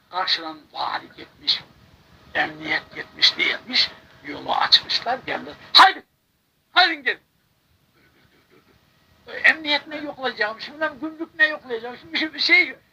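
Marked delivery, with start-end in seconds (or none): 5.7-7.2 high, loud come-on; higher, louder come-along call; eager call. Retold or imitated. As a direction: wave them through cheerfully. Officials waving them on.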